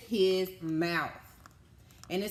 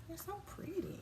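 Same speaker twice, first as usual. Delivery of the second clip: soft admiring; 10 semitones higher, 13 dB quieter than usual. Real, not acted. Admiring picture with kids.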